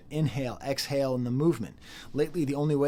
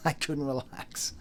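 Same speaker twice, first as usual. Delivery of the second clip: ordinary delivery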